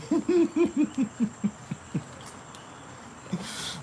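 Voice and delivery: a real laugh, high, squeaky and airless; high breathless laugh. Real, not acted. Vlogger laughing at her own Logan impression, high breathless laugh.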